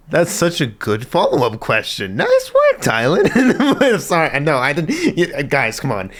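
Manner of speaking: loud, high, cracking up; laughing exclamation. Real, not acted. Viewer delighted by a follow-up question, laughing loudly. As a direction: shout through real laughter.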